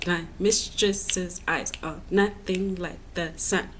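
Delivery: "My mistress's eyes are nothing like the sun" in a slow, even beat; stressed-unstressed pattern. Shown as a demonstration. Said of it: "it's almost like a pattern".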